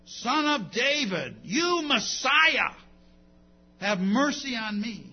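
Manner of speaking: loud, drawn-out plea; crying out. Acted. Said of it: "cries out".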